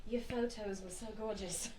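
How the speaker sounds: proper British. Shown as a demonstration. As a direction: put on proper English manners. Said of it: "like, the proper".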